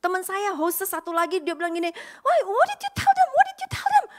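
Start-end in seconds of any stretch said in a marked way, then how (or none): 2.2-4.0 very high, loud, tearful outburst; mock angry scold. Hostess friend scolding her over the wait, shrill loud outburst. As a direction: shriek it like a frantic coworker.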